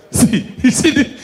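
ordinary talk

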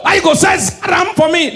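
high, loud indignant yell; mock angry shout. Prophet shouting at the Igbo boys, mock angry. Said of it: "Now Igbo call the prophet".

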